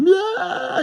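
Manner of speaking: high-pitched imitated scream; panicked wail, acted out while retelling; mock scream. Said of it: "He's freaking the fuck out".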